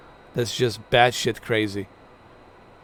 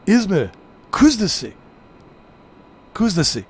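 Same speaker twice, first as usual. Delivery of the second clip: Izmir Kuzdasi barked higher and louder, like an angry man; mock pissed-off; 6 semitones higher, 3 dB louder than usual. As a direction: spit out Kuzdasi as if furious. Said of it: "Sounds like, like somebody's pissed off".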